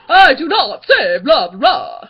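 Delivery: very loud, slow vampire protest; booming offended vampire impression; indignant cartoon vampire. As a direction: deny it in a hammed-up vampire voice. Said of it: "And he's like".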